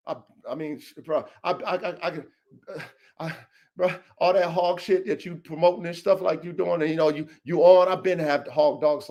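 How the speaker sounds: low, soft, fumbling imitation of a rival; quiet stammering mock of the rival. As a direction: play the rival fumbling for excuses.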